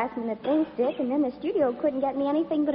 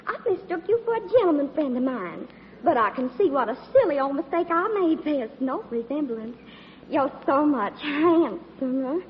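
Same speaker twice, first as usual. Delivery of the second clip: Southern belle routine. Pretend. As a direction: apologise sweetly in a put-on Southern accent. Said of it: "my little old southern routine".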